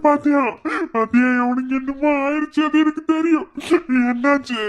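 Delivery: high, loud anguished wail; distraught cry. Acted. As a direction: cry out that she knew. Wife's fear confirmed, distraught.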